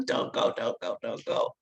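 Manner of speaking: desperate husband's whispered plea, don't go; a husband whispering his plea through glass; whispered pleading. Retold or imitated. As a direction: breathe the begging through the car window. Husband begging at car window.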